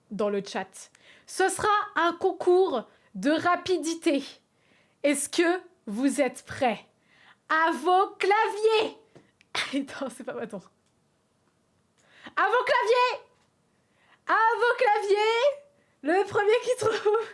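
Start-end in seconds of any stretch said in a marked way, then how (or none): 1.3-9.0 loud, drawn-out game-show announcing; rally the contestants, building in pitch and volume; slow, emphatic host's call to the start line; contest announcer. Pretend. Hosts a mock speed contest. 12.4-17.3 high, loud, stretched-out announcer call; over-the-top game-show host yell; shouted contest start. Pretend. Starts the chat speed contest.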